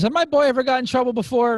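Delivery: put-on high, loud Italian-accented father. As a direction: protest loudly in a light Italian accent. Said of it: "kinda Italian accent".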